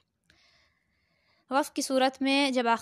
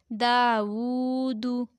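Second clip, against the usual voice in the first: Dawood with "daa" and "woo" held long, final "du" short; long first two syllables; much slower than usual. Shown as a demonstration. Teacher showing which letters to lengthen, Dawood drawn out. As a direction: stretch Dawood slowly, releasing the last syllable quickly. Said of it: "सिर्फ दा को लंबा करेंगे".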